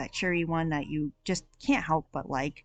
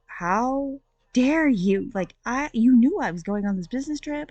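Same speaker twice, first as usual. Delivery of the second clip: indignant; 6 semitones higher, 6 dB louder than usual. Retold or imitated. Heroine confronts cheating fiance.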